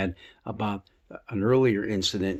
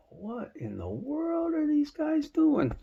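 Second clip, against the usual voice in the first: much higher, slow astonished wondering; amazed disbelief; slower than usual. Acted. Onlooker watching sawdust fall through the roof, astonished. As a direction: stretch it out in wide-eyed wonder. Crowd sees roof torn open.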